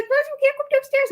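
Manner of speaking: high, cutesy voice for the dog; mom voicing the dog. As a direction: speak for the pet in a silly high voice.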